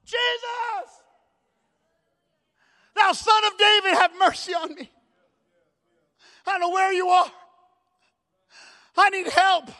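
high-pitched cry from a blind beggar; imitated yelling out. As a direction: act out calling desperately for help. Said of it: "And he yells out".

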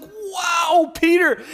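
high, wide-eyed gasp of wonder; mock awe. Disciples marveling at Peter walking on water, high amazed voice. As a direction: marvel at him like starstruck fans. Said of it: "Imagine the disciples like".

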